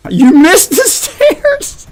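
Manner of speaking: high, slow laughing taunt. Real, not acted. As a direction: crack up mid-word. Teammate laughs at fumble.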